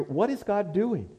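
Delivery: ordinary delivery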